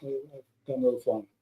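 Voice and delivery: put-on soft, understated mumble of an old man; old pilot quietly admitting he flew. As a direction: mumble it modestly, almost to yourself. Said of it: "he started to mumble a little bit".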